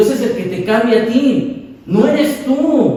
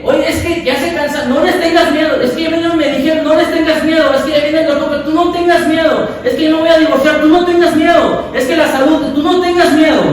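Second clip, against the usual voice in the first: higher and louder, building fervour; fervent preaching; 6 semitones higher, 3 dB louder than usual. Acted. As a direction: preach it with rising force. Preaching at full force. (predicación ferviente y en aumento)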